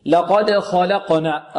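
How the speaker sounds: slow لقد خلقنا, heavy خ and light ل; تفخيم وترقيق. Shown as a demonstration. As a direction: recite لقد خلقنا carefully, dark خ against thin ل. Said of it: "الخاء مفخمة واللام مرققة". (تلاوة لقد خلقنا بخاء مفخمة ولام مرققة)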